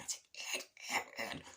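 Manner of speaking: soft whispered name, repeated; acted hushed calling; urgent whisper. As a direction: whisper to catch his attention. Secretary trying to get Rooney's attention.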